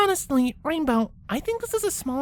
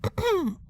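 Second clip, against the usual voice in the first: throat clearing; 5 semitones higher than usual. Acted. Twilight about to correct Rainbow, throat clearing. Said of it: "clearing her throat".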